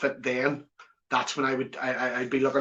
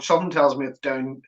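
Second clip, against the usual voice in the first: normal talking voice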